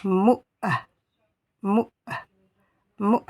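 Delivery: "Mou" articulé du fond de la gorge; vowel from the back of the throat. Shown as a demonstration. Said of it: "du fond de la gorge".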